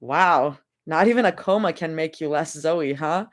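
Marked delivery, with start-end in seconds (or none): none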